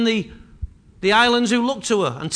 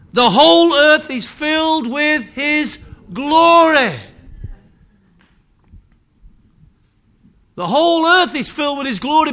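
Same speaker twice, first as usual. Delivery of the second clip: loud, higher declaration of glory; preaching proclamation; 6 semitones higher, 6 dB louder than usual. Acted. Preacher declaring God's glory to the church, loud. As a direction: proclaim it over the music.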